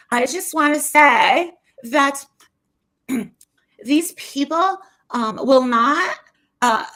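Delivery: slow, drawn-out mocking impression; impersonating Dre. She imitates Dre exiting the live, slow mock voice. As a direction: stretch each phrase, pitch lifting at the ends. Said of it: "[name] is impersonating Dre".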